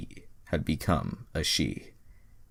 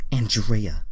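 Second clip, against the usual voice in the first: put-on girl giving name; 5 semitones higher, 6 dB louder than usual. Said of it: "Andrea said".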